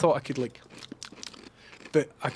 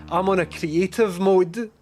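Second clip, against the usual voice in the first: mock whining; 7 semitones higher, 6 dB louder, slower than usual. Mock complaint about panther.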